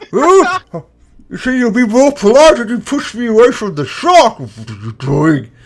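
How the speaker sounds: high, loud, prissy indignation; a very polite lady outraged at being shoved; indignant high voice. Acted.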